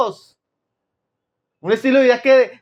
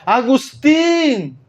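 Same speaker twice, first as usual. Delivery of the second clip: drawn-out call, voice raised in pitch; 4 semitones higher, much slower than usual. Retold or imitated. Woman calls to Agustín across a plaza, calling out. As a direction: stretch the name as if hailing someone across a plaza. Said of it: "comenzó a llamarlo Agustín".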